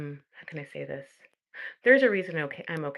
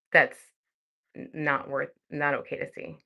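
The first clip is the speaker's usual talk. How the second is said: ordinary talk, nothing marked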